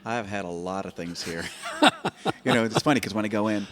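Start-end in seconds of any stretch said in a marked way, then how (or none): 1.5-2.4 loud high laugh bursting out; burst of laughter. Real, not acted. Laughs at own joke.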